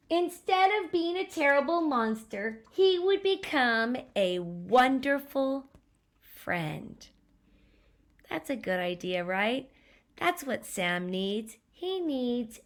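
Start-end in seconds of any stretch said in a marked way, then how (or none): 0.1-7.1 put-on lively read-aloud to a child; storybook narration. A narrator's reading. Parent reading a picture book to a child, animated narration. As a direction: tell the story with bounce.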